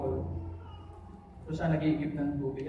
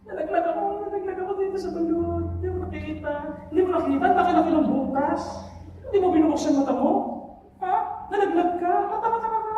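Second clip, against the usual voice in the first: high, loud, hammed-up indignation; exasperated quarrel; 11 semitones higher, 6 dB louder, faster than usual. Young man in the well scolds the businessman, loudly exasperated. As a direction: fire back fast and loud in a high voice.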